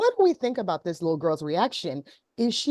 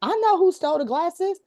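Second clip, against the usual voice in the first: put-on high, loud defiant child's voice; imitating a little girl; 13 semitones higher, 4 dB louder than usual. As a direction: play the girl blurting out her auntie did it. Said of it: "she's like".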